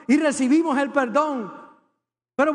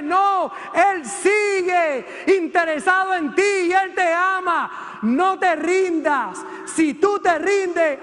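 fervent preaching shout; 4 semitones higher, slower than usual. Real, not acted. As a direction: proclaim it to the whole church, slowly. Preacher's climax of reassurance. (grito de predicador, lento y fervoroso)

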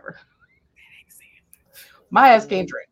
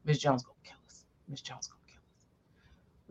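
scared whisper; 7 semitones lower, 12 dB quieter than usual. Retold or imitated. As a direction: whisper it like frightened teens. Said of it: "Everybody calling me".

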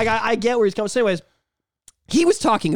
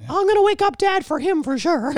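very high, fast squeaky cartoon mouse scheming; 11 semitones higher than usual. Mickey Mouse plotting against [name], squeaky.

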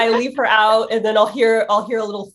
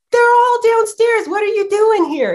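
voicing the dog; 13 semitones higher, 4 dB louder than usual. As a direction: hurry him downstairs.

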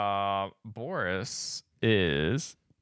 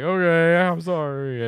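mock frightened apology; 6 semitones higher, 5 dB louder, slower than usual. Voices frightened man after gunshots.

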